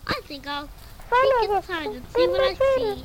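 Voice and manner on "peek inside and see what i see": character voice. Acted. Story character speaks.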